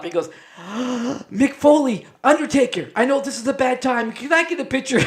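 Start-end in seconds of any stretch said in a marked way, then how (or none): 0.6-1.2 sharp high gasp of a fan; imitating a fan's gasp; starstruck gasp. Starstruck fan spots Mick. 1.3-5.0 higher, louder, gushing fan voice; imitating an excited fan, pitched up; starstruck fan. Starstruck fan begs for photo.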